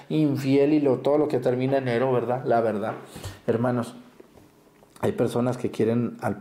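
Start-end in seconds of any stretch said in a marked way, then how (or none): none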